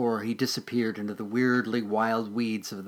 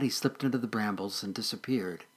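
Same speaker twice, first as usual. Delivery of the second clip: usual speaking voice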